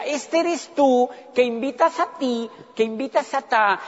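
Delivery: lightly voiced dean explaining slowly; a hint of a patronizing lecturer at the board; mock pitch of the scheme; mimicking the dean. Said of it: "Y decía".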